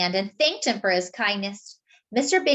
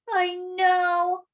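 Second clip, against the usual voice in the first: fighting back tears; 10 semitones higher, 4 dB louder than usual. A narrator's reading. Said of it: "fighting back tears".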